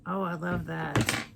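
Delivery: normal talking voice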